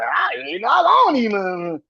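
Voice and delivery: high, loud, rushed disbelief; amazed retold reaction. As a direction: blurt it out high, cracking up at yourself.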